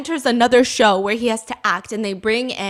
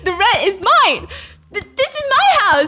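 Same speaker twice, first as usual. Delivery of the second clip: higher, louder, bewildered protest; confused insisting; 7 semitones higher, 6 dB louder, faster than usual. Retold or imitated. Landlady protesting to the odd tenant, flustered and higher. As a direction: insist it's your house, flustered. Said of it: "she's so confused".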